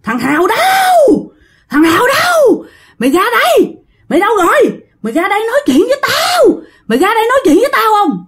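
high-pitched demanding yell; acted possessed woman shrieking for someone; screaming out. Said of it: "cổ mới la làng lên".

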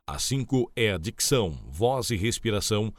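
usual speaking voice

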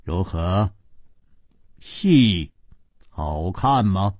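low, slowing, understated question; smiling tease. Acted. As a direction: ask slyly with a slight smile, pausing. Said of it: "竟微笑着说".

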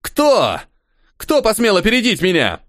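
high furious shout; angry shout. A narrator's reading. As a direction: scream it in rage. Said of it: "он зло закричал".

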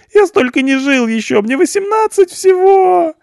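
very high, loud terrified squeal. A narrator's reading. Punk hears twenty years, squeals in terror. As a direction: shriek in panic at twenty years.

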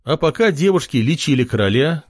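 usual speaking voice

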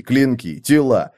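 usual speaking voice

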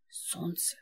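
soft hissed warning, voice gone strange; hissing feral vampire. Acted. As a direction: hiss it from the dark like a vampire. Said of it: "прошипели в ответ".

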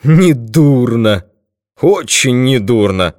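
stretched-out, menacing mock approval; dreadful mocking exclamation. A narrator's reading. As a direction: exclaim it with grim, drawn-out relish. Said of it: "страшно захохотал и воскликнул".

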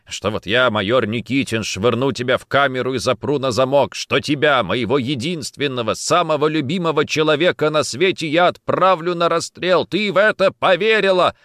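mounting, impassioned reproach; indignant. Acted. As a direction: work up from disbelief to hurt outrage. Indignant that she believed his threat.

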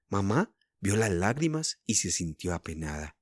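ordinary delivery, nothing marked

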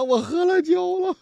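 high, tearful, whiny confession; mock crying. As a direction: play the weeping drunk. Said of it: "哎呀，哭的呀". (学哭腔，声音高)

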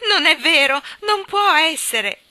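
put-on loud, high child's protest; desperate cry. Jane will not believe it, shouting in distress. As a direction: cry out in denial, dragging the last words. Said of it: "gridò Jane".

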